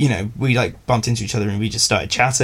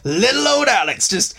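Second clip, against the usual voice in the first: higher mocking voice of the friends; mock pitying; 7 semitones higher than usual. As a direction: sneer at little old Alex in a high put-on voice. Mocking friends behind his back.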